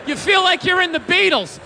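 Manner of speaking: usual speaking voice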